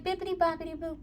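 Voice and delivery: high, sing-song fairy godmother voice. Retold or imitated. Fairy card waving her little wand, high sing-song fairy voice. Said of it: "She's like".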